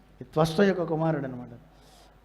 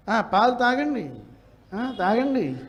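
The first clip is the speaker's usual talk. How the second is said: mocking sarcasm; 3 semitones higher, slower than usual. Shown as a demonstration. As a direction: sneer that the guest got it free. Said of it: "అర్థం మారిపోవట్లే".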